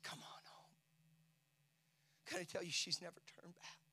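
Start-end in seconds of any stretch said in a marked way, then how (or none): 0.0-0.7 whispered invitation. Retold or imitated. Father answers daughter at night.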